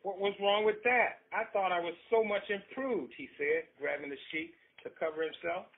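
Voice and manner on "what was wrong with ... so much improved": high, loud indignant protest. Acted. Husband defending himself to his wife, a high indignant protest.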